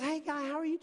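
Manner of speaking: put-on bright, high, friendly greeting to kids; a hint of a bubbly young attendant; cheerful attendant. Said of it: "the kindest person to all these kids".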